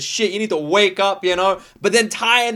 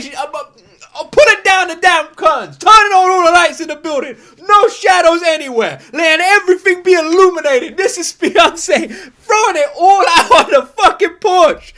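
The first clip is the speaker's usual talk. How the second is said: loud, higher excited raving; 6 semitones higher, 6 dB louder than usual. Real, not acted. Telling viewers how raw [name] is, loud excited raving. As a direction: rave about it at full volume. Raving about the video's honesty.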